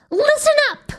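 put-on very high-pitched girl's shriek. A narrator's reading. Girl shrieks at a room of busy kids, shrieking. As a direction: shriek it to grab everyone's attention. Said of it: "shrieked Pink".

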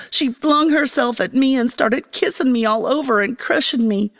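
ordinary delivery, nothing marked

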